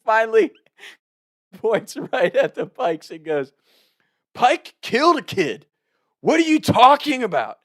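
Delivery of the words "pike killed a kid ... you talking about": high, loud accusing yell; mock drunken outburst. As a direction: blurt out the roast at full voice. Drunk friend's roast of rival fraternity.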